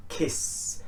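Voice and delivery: "kiss" with a long hissed s; hissing S. Shown as a demonstration. Teacher showing the S ending, hissing. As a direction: draw out the hiss at the end of "kiss". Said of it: "S is very hissing".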